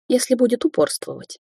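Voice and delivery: usual speaking voice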